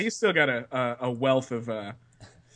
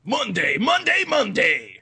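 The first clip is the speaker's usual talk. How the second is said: monster truck ad; 9 semitones higher, 5 dB louder, slower than usual. Acted. S2 bursts into a hammy hype call.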